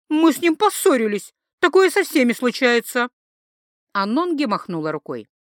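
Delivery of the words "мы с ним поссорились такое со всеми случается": higher-pitched, hurried-to-explain wife; defensive. Acted. Wife excuses a quarrel with her husband, high and defensive. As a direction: defend yourself in a raised, higher voice.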